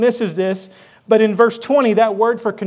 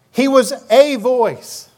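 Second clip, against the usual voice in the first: slow, with "a" drawn out as "ay"; stress on "a"; 3 semitones higher, slower than usual. Shown as a demonstration. Preacher driving home the indefinite article, stressing "a". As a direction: hit "a" as a long "ay" before "voice". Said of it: "A or the?".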